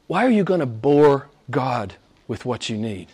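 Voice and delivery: ordinary delivery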